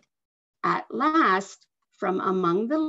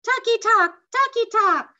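very high, loud bird call; hornbill imitation; 13 semitones higher, 6 dB louder than usual. A narrator's reading. Girl echoes the hornbill's call, very high and loud. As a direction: mimic the hornbill's cry.

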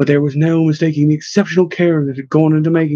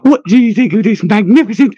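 high, lilting cheery greeting; bubbly and upbeat, pitched up; cheerful; 6 semitones higher, 4 dB louder than usual. A narrator's reading. Henwin cheerfully greeting his friend at the old church, cheerful. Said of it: "greeted her cheerfully".

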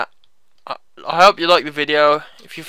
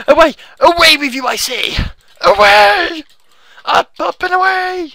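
high, loud theatrical command; grandly over-the-top exclamations; mock-heroic banishing; 13 semitones higher, 7 dB louder than usual. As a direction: cry it out like a hammy hero.

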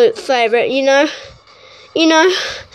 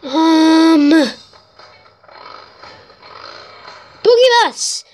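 excited protest; 5 semitones higher than usual. Real, not acted. Gamer fighting the vehicle picker, high excited protest. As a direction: squeal at the game, then cheer. Game picks the wrong vehicle.

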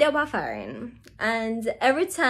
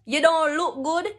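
ordinary talk, nothing marked

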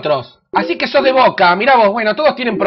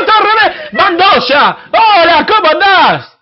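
usual speaking voice

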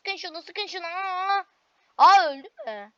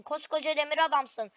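normal talking voice

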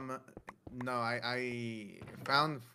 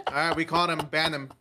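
loud, raised-pitch mock verdict; mock accusing shout; 5 semitones higher, 11 dB louder, faster than usual. Teammate jokingly calling for a ban, mock shout. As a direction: call it out loudly like a playful accusation. Jokey call to ban the player.

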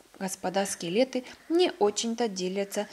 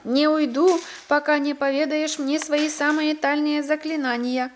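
high, loud, insistent storybook shout; defiant shout; 6 semitones higher, 6 dB louder than usual. A narrator's reading. Hero demanding spells from inside the giant, shouting defiantly. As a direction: shout your demand and refuse to budge. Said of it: "закричал Ваня Мёнин".

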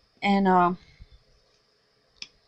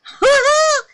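high, loud burst of laughter; 10 dB louder than usual. Real, not acted. Surprised by the rock-hard soap, laughing loud.

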